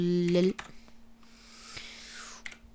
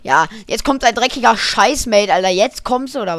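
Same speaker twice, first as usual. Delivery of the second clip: loud angry taunt; yelling across the room; 9 dB louder than usual. Real, not acted. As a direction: yell at the enemy. Yelling at enemy.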